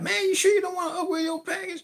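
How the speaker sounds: loud, very high-pitched salesman voice; imitated store clerk. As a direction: squeal the upsell like a pushy clerk.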